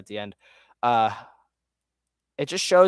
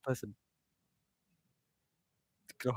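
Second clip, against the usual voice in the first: ordinary talk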